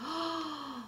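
plain reading voice

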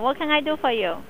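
ordinary delivery

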